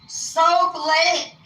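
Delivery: high, stretched "Soap Lake"; exasperated correction. Retold or imitated. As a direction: push out "Soap Lake" slowly for slow listeners.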